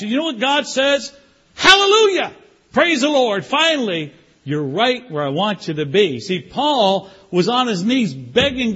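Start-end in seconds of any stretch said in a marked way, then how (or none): 1.5-6.2 God cheering that you finally came; joyful God. Acted. God voiced rejoicing in sermon.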